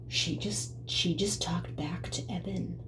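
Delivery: gossiping hushed whisper; breathy scandalised whisper between relatives. Retold or imitated. Mom and sister whispering.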